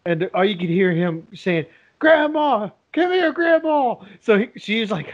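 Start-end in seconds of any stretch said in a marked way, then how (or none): 2.0-4.0 put-on high, pleading kid's call for help; imitated child calling. Spanked boy calling his grandma from another room, imitated high call. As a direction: mimic a little boy calling out, pitched up.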